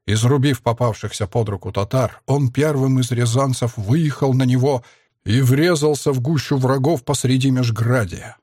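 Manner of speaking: ordinary delivery, nothing marked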